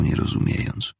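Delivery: ordinary delivery, nothing marked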